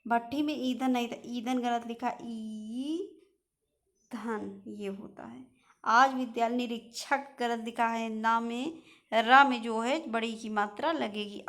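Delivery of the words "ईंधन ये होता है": breathy whisper, slow and hushed. Real, not acted. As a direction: whisper the word slowly to the class. Teacher dictating correct spelling.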